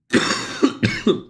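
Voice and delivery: dry rasping cough; real sick cough. Real, not acted. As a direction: cough like you have the flu. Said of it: "I'm losing my voice".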